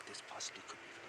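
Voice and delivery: hushed whisper; golf commentator. Real, not acted. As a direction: whisper tensely. Hushed commentary before a big putt.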